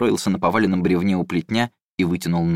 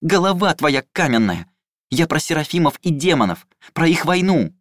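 high, louder scolding shout; exasperated shout; 7 semitones higher, 3 dB louder than usual. A narrator's reading. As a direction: yell at the stubborn golem. Ilai shouts at golem.

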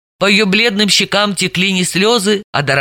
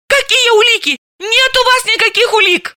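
high, shrill-pitched outburst of a frantic woman; flaring up and shouting. Acted. Suspect to detective, flaring up in high panic. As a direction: cry out in indignant panic. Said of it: "вспыхнула и опять закричала".

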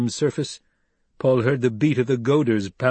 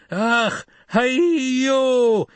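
high, loud ringing call; traditional shout; 13 semitones higher, 4 dB louder than usual. Acted. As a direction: call out across the moving worm. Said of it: "he shouted in the traditional call".

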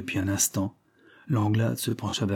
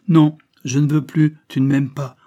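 loud, raised-pitch rejection; heated refusal; 5 semitones higher, 8 dB louder than usual. A narrator's reading. Lover rejecting his mistress's plea, heated refusal. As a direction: refuse forcefully, hitting the first words hard.

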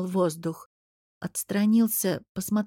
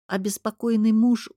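ordinary delivery

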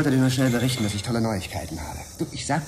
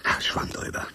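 whisper. Acted. Reconciling with Eva quietly.

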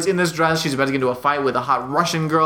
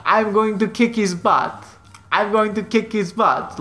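slow, higher mimicking Russian girl; mocking repeat; 5 semitones higher, slower than usual. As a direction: parrot it back slowly like a mimic. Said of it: "she starts repeating this".

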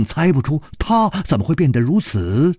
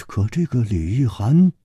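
ordinary delivery, nothing marked

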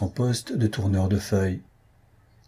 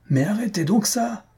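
very high indignant protest, without real shouting; vociferating; 12 semitones higher than usual. A narrator's reading. Host to guest over the chiming clock, vociferating. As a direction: protest in a high, acted outrage. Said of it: "vociférait Jujube".

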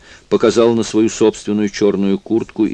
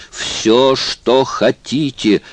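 slow, higher desperate repeat of что хотите; 6 semitones higher, much slower than usual. A narrator's reading. Stoyt begging Obispo outright, slow and desperate. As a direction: give up reason and just beg. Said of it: "отбросив всякие апелляции к разуму".

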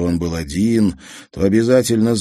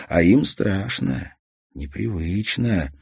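ordinary delivery, nothing marked

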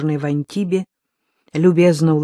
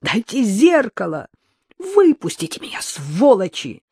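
high, loud frantic screaming; 9 semitones higher, 4 dB louder than usual. A narrator's reading. Captive woman screaming at her jailers, frantic. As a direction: shriek to be let out.

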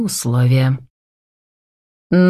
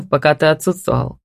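plain reading voice

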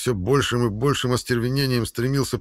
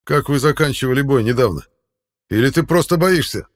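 taunting; 5 semitones higher, 6 dB louder than usual. A narrator's reading. Hauk taunts the knight.

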